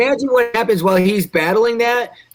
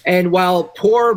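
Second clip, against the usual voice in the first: ordinary talk, nothing marked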